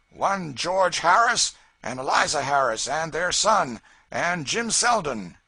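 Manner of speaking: bully them with the warrant; Tom Locker calling up. A narrator's reading. Tom to George on the rock, loud demand.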